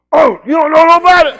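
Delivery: loud high accusing yell; mock angry shout. Woman yelling at him over the phone.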